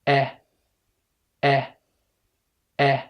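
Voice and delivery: "أ" as brief eh for repeating; isolated "أ" as the vowel in set; short English E. Shown as a demonstration. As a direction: open slightly on a short "أ", like eh. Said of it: "وافتح بقك بنفس الطريقة".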